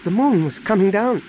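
high-pitched, defensive protest; flustered. A narrator's reading. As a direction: plead your excuse, pitch jumping up. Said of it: "stuttered over several replies".

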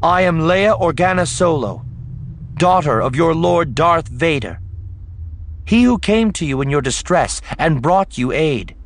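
high, ringing proclamation; loud voice. A narrator's reading. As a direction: declare your lineage loudly to the assembly. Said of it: "she said in a loud voice".

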